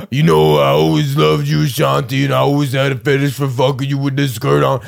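playfully imitating [name] serenading [name]; [name] impression. Imagining [name] confessing to [name] in old age, mock-romantic voice. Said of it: "[name] be like".

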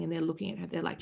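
normal talking voice

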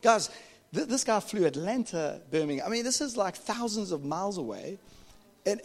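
ordinary delivery

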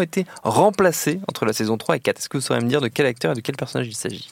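deliberate quiz-master reading; quiz host read. Acted. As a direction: pose the quiz question like a game show host. Host reads quiz question.

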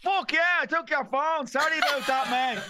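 very high put-on voice; hammed-up high-pitched admission; acted breezy apology. Acting Willa's cheery apology.

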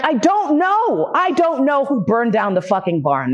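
high-pitched, exasperated mock yell; pissed off. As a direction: draw out the protest, then let it drop. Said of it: "I started to get really pissed off".

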